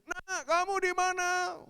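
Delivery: high, loud, drawn-out shout; father calling a hidden child; slow searching call, pitched high. Retold or imitated. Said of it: "persis tuh kejadiannya".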